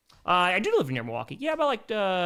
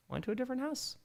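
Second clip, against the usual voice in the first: lifted high, softer, playful; high soft repeat; 8 semitones higher, 10 dB quieter, faster than usual. Pretend.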